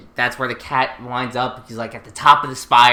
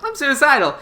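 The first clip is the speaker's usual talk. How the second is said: higher mock echo; 11 semitones higher, 3 dB louder than usual.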